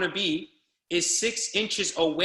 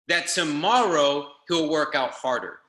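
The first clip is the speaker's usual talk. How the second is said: ordinary talk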